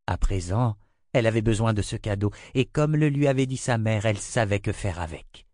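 ordinary delivery, nothing marked

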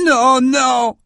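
high, tearful pleading cry. Acted. Shaman pleads to save his king, high tearful cry. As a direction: cry out near tears, pitched high. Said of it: "cria-t-il des larmes aux yeux".

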